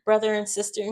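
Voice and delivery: high, soft, self-satisfied imitation; imitating someone pleased with her news; mock smug. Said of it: "Haley is all, like, smug".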